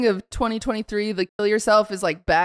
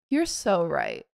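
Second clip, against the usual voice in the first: mock agreement; 5 semitones lower, 5 dB quieter, slower than usual. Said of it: "quick switch".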